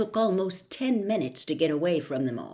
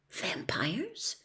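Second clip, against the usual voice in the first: breathed, barely voiced; boy echoing in disbelief; stunned whisper; 9 dB quieter than usual. Harry stunned.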